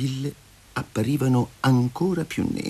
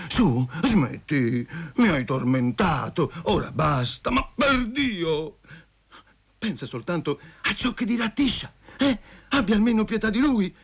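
desperate pleading; 7 semitones higher than usual. Acted. Tormented man begs Masha to stop, desperate. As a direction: beg her, pitch climbing in exasperation.

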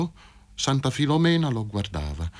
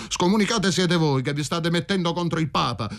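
a higher-pitched shout flung back; 8 semitones higher than usual. Acted. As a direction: fire the retort at the crowd. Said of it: "gridò Michele".

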